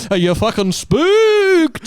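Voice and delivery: high, drawn-out howl on the last word; mock spooky shout. Host teasing friend after the spooky story, mock spooky shout. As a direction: wail it like a hammed-up ghost.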